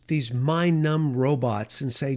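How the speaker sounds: ordinary delivery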